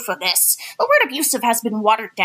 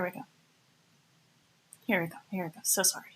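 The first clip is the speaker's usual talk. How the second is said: whispered, softer apology; 6 semitones lower, 13 dB quieter, much faster than usual. Real, not acted. Apologising for screen mix-up.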